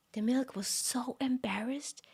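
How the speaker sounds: hushed, breathy whisper after a gasp. A narrator's reading. Storyteller to listeners, a shocked gasping whisper. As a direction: let the shock steal your voice. Storyteller gasps at milk's shame.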